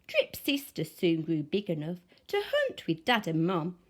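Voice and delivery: plain reading voice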